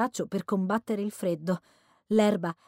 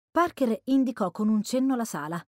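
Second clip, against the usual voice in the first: usual speaking voice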